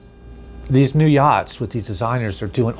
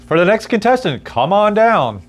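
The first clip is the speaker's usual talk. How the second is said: game show host; 9 semitones higher, 3 dB louder than usual. Acted.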